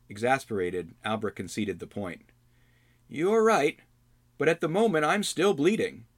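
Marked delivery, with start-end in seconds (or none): 3.1-6.1 put-on weary young hero. Albrecht concedes.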